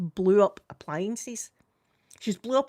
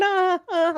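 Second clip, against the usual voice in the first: put-on high, pained child cry, much higher; imitating grandson's cry; 10 semitones higher, 5 dB louder than usual. Grandma imitating grandson crying during a phone call. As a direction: act out the toddler's fake howl. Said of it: "like that while I was on the phone".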